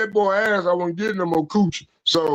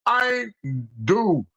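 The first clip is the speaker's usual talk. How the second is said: ordinary delivery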